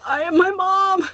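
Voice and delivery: high, loud mock-dismayed cry; mock wail. Daughter realising she cries like mom, mock wail.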